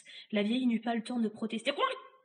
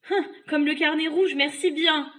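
loud, higher sarcastic refusal; scornful retort; 6 semitones higher, 8 dB louder than usual. Acted. Boy refusing the witch's gift, loud scorn. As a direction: throw the offer right back.

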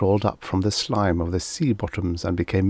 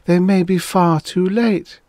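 high, loud lament; sad; 10 semitones higher, 5 dB louder than usual. A narrator's reading. She fears rescue comes too late, sad. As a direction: mourn it in a raised, high voice. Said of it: "spinning sadly over the ground".

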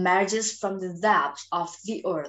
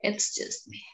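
whispered; 7 dB quieter than usual. Acted. Alone after everyone leaves.

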